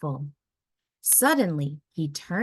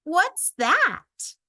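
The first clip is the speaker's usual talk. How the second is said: put-on high, eager child voice; curious child; 11 semitones higher, 5 dB louder than usual. A narrator's reading. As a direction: ask like an eager kid.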